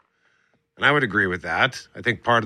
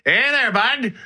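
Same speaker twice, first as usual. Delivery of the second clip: put-on higher, lively Canadian hockey-bro accent; 9 semitones higher, faster than usual. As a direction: ham up a Canadian hockey bro inviting a buddy out. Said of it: "young Americans are saying things like".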